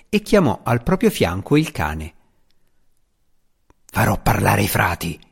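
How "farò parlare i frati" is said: lower, gruff swearing vow; grim vow. A narrator's reading. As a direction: swear it darkly. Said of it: "giurò il cacciatore di taglie".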